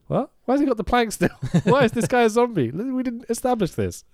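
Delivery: high, incredulous mock confusion; voicing puzzled audience members, incredulous; mock baffled viewer. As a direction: play the confused moviegoer who can't follow.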